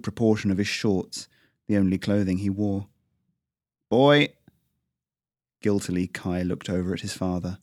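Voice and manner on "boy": loud, high bark of a name; sharp shout. Acted. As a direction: snap the boy's name out. Father calls the dirty boy.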